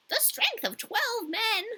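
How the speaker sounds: put-on high, slow old woman echoing; the old Finland woman, pitched high and slow. A narrator's reading. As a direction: repeat it slowly, high and wondering. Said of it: "same voice as the Lapland woman".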